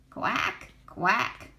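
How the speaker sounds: short duck quacks; quacking ducks. A narrator's reading. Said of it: "said the ducks".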